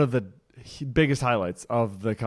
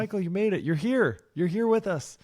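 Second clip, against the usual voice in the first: mock delighted greeting; 8 semitones higher than usual. Retold greeting to a photo.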